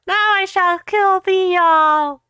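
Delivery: high, loud, slow dark lord voice; hammy villain threat. Acted. Voldemort threatening the whole room, hammy and slow. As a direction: drag out the threat like a panto villain.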